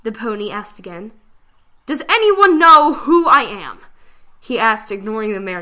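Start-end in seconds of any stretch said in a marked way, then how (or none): 1.8-3.8 high, loud, slow plea to the crowd; calling out. Acted. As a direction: call out to everyone, lost. Lost prince calls to crowd.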